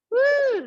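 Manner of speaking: high, loud "woo"; high-pitched exertion whoop; effortful whoop. Real, not acted. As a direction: let out a sharp whoop of effort. Exertion during exercise count.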